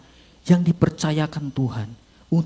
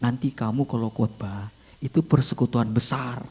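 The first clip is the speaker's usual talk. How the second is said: mysterious inner voice; 7 semitones lower, 7 dB quieter than usual. Acted. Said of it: "tiba-tiba ada suara bilang begini".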